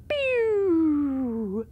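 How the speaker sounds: playful zap noise; quick cartoon laser pew; laser pew sound. Testing the steering controls.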